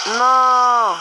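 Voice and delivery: loud high cry of no; dismayed shout. Real, not acted. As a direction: yell out in despair. Gaming loss reaction.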